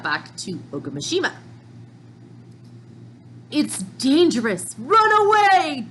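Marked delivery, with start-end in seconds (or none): 3.5-5.9 panicked. Acted. Citizens fleeing in panic.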